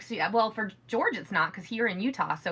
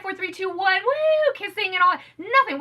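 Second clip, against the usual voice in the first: usual speaking voice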